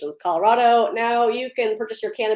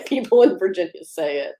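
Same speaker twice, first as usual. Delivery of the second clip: usual speaking voice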